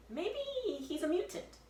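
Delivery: put-on high, doubtful boy voice; high suspicious boy; Jack suspicious. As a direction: wonder it, high and wary.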